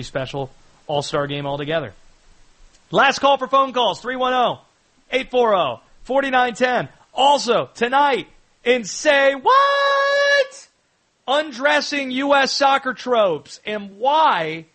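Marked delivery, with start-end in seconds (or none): 2.9-14.6 loud, high-pitched show tease; announcer's hype. Real, not acted. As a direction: belt out the upcoming segment like a promo. Host's loud tease before the break.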